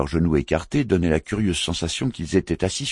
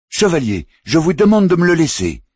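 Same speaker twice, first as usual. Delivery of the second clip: furious high shout, much louder; roaring; 9 dB louder than usual. A narrator's reading. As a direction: bellow it, claiming the fight. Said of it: "rugit Philpas Moussa".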